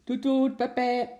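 high, loud imitated honking; impatient car horns. A narrator's reading. Cars stuck in a traffic jam, impatient honking. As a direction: toot like waiting cars. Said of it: "begonnen ongeduldig te toeteren".

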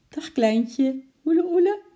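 high, loud cooing; baby talk. A narrator's reading. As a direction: coo to a baby in a high singsong. Shopkeeper coos at supposed baby. (hoog kirrend babypraatje)

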